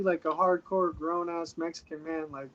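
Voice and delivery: ordinary talk, nothing marked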